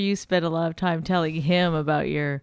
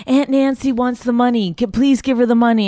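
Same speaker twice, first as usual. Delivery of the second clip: raised, louder mock-urgent plea; frantic imitation; 5 semitones higher, 6 dB louder than usual. Woman retells daughter's call to father, imitating frantic pleading. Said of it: "your daughter calls you frantically".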